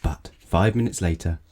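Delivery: plain reading voice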